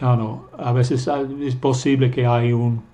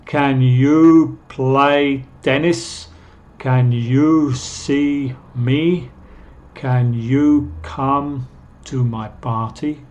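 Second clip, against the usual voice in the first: very slow, drawn-out words with lifted "you"; deliberate, stretched teaching examples; slow model questions; much slower than usual. Shown as a demonstration. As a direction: read each question slowly for learners.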